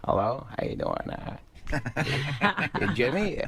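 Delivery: lightly voiced mumbler on the phone; soft mumbled hello, rising at the name; Adam Sandler. Guest replays Sandler answering the phone, lightly mumbled. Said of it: "He's like".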